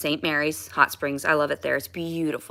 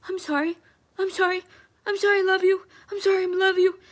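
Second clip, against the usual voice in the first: high, frightened repeated sorry; pleading apology; 12 semitones higher, faster than usual. Retold or imitated. Pregnant and arrested, pleading with the officer, high and frightened. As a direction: beg like a scared pregnant prisoner. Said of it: "And I'm like".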